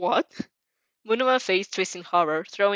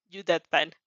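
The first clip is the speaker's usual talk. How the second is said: plain reading voice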